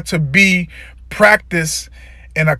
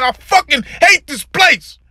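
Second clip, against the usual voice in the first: pained; 8 semitones higher than usual. Real, not acted. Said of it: "You can hear the pain in my voice".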